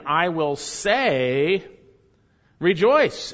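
drawn-out build to a punched REJOICE; emphatic preacher's quote, landing hard on "rejoice". Acted. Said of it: "And it's emphatic".

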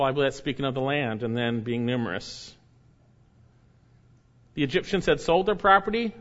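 normal talking voice

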